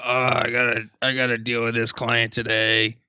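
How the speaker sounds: low, groaning reluctant voice; dreading the day, a low put-upon groan; weary client dread. Pretend. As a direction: drag yourself out of bed sounding miserable. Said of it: "I can't do the voices like you do".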